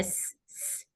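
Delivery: स- as voiceless hiss of air; airy sibilant demo. Shown as a demonstration. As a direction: let the air rush out on स-. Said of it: "सिफत हमस वाला है और सीटी वाला है".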